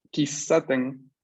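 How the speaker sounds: Kisaten lento, con la S alargada; S duplicada. Shown as a demonstration. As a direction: detente en la S doble de Kisaten. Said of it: "hay una S duplicada". (pronuncia Kisaten despacio, marcando la consonante doble)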